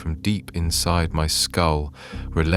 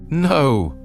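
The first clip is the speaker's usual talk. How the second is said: sharp high gasped no; 9 semitones higher, 4 dB louder than usual. A narrator's reading. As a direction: gasp it out in shock. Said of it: "I gasped".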